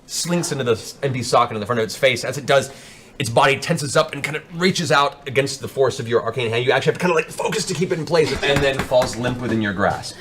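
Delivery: ordinary delivery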